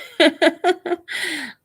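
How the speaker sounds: bright high-pitched laugh. Real, not acted. Laughs at a comment.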